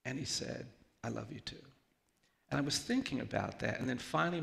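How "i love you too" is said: softly voiced, retold whisper; whispered, flawless. Little boy hugging back, softly whispered reply. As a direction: say it softly and simply, without stumbling. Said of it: "he whispered flawlessly in my ear".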